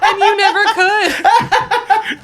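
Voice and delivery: high, loud, drawn-out words through laughter; laughing. Real, not acted. Guest recalling dad's disappointment, laughing hard. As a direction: barely get it out for laughing.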